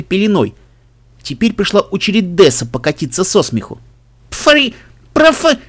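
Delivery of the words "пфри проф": high-pitched spluttering; sputtering. A narrator's reading. Sister thrown into a snowdrift, spluttering. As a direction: sputter as if spitting out snow.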